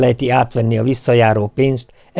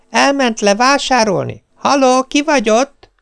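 high chirpy imitation of a phone caller; mimicked phone voice; 12 semitones higher than usual. Parrot mimics a caller on the phone, high and chirpy. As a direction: chirp it like someone answering the phone.

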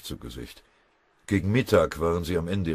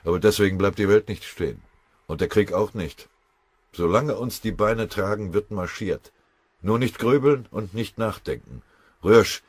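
louder and higher, driving the men on; rallying push; 5 semitones higher, 7 dB louder than usual. A narrator's reading. Pfennigbauer urging his weary comrades onward, loud and insistent. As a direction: stiffen their spirits, no room for brooding. Sergeant rallies exhausted soldiers.

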